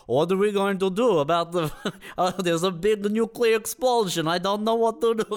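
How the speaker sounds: high-pitched mock Russian accent; hammed-up Russian accent, cracking up midway; silly Russian accent. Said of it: "one of the silliest accents".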